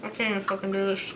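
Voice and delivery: talking with the mouth held open; words slurred through an open mouth; mouth propped open. Real, not acted. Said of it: "Can't close my mouth".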